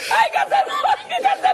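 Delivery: squealing very high and loud with laughter; excited squeal. Real, not acted. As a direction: shriek it out, laughing. Squealing while eating pepper prank.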